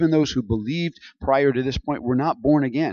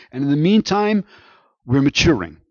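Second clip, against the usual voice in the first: louder preacher's proclamation; preaching with force; 2 semitones higher, 4 dB louder than usual. Acted. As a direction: drive the verse home to the church. Preaching climax on purity.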